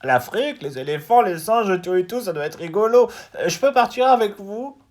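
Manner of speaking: high, loud frog voice full of hope; eager little frog. Acted. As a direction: beg to join the trip. Frog asks to come along.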